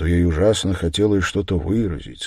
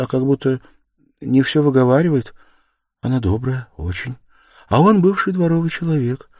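put-on higher-pitched voice of a meek young woman; timid Sonya, kindly explaining; 8 semitones higher, 4 dB louder than usual. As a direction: speak up high, artlessly describing the landlords. Narrator plays meek Sonya chatting. (робкий высокий голос Сони)

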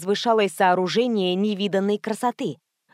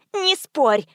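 higher-pitched irritated retort; cross, raised voice; 6 semitones higher, 4 dB louder than usual. A narrator's reading. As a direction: cut off the objection crossly. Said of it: "обозлилась брюнетка".

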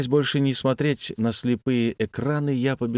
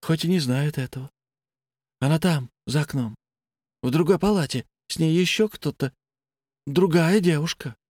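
higher, eerie calm patient; a detached, unsettling patient; blind patient voice; 5 semitones higher than usual. Acted.

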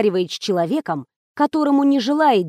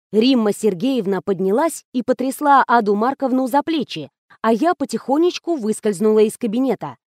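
usual speaking voice

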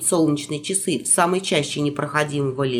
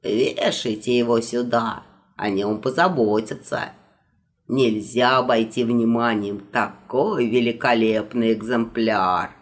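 put-on old magister voice; 8 semitones lower than usual. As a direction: lecture like a fussy old wizard. Magister admires the carpet.

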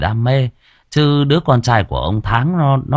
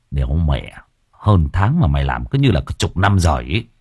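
plain reading voice

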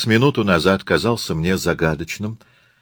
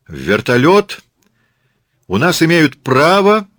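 high, raised declaiming voice; ringing proclamation; 9 semitones higher, 5 dB louder than usual. Acted. Katyusha addresses the tourists, proclaiming ringingly. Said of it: "звонко провозглашает Катюша".

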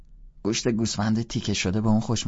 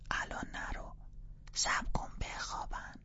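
soft whisper, much softer; 12 dB quieter than usual. Acted. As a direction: plead under her breath. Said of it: "فیبی زیر لب گفت".

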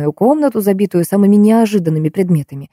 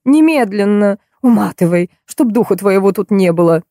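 narrated angry order, only mildly raised; angry bark; 2 semitones higher than usual. A narrator's reading. Furious actress throws out her make-up artist, barking. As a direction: send the person packing. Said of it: "рявкнула Щепкина".

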